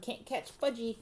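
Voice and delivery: put-on high-pitched toddler taunt; hollering. A narrator's reading. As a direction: taunt them like a little boy running off. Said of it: "he hollered".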